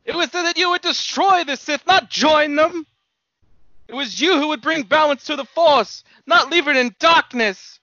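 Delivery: high, impassioned outcry with loud bursts; anguished reproach. Acted. Obi-Wan berates his fallen chosen one, high anguished outcry. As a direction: cry out your betrayed hopes, pitched high.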